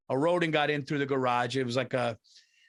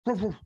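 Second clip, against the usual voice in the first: short muffled dog woofs; imitated dog bark; 4 semitones higher than usual. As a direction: woof like an uneasy dog. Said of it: "the dog was going like".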